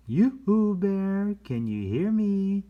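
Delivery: high, loud sing-song call of yoo-hoo; high, loud playful yoo-hoo to a hidden bear. Acted. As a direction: call across the woods in a high voice. Reading a character calling out.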